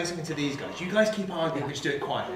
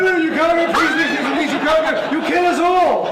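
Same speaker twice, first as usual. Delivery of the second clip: high, loud panicked pleading; frantic begging; 12 semitones higher, 8 dB louder than usual. Acted. Edmund begs the fleeing players not to go, high panicked pleading. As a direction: plead as if their lives depend on it.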